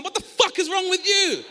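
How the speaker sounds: high loud disbelief; exasperated shout. Retold or imitated. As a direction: yell at the weirdo.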